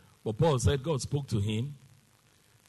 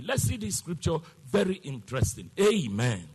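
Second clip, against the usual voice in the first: usual speaking voice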